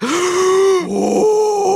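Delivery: loud high-pitched gasp; shocked gasp. Acted. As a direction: gasp in sudden horror. Shocked at the tax form.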